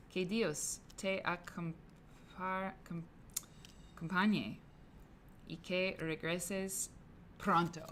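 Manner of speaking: slow, careful Spanish reading; slow read-aloud. Retold or imitated. Reading the Spanish farewell note slowly aloud. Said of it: "notes in Spanish".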